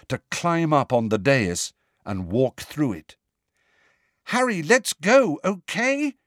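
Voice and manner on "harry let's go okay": high, insistent plea with a rising "okay"; pitched-up, pushy and anxious; forceful urging. A narrator's reading. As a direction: press a friend firmly to leave. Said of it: "said Hermione more forcefully".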